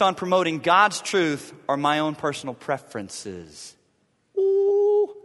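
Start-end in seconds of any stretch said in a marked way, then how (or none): 4.4-5.1 ooh. Real, not acted. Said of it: "think that one through".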